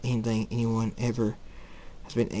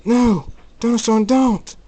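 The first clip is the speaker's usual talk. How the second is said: high, loud cry to stop; desperate shout; 12 semitones higher, 9 dB louder than usual. Acted. Miles begs Thunderstorm not to go, shouting desperately. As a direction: yell after them in alarm.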